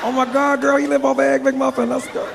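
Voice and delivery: put-on high, excited girlfriend voice; mock swooning woman; gullible impressed woman. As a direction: squeal it to a girlfriend, pitched high.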